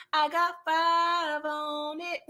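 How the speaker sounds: slow, laughing line. Retold or imitated. Retelling Seamus refusing the money, laughing drawl. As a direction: stretch it out through a laugh.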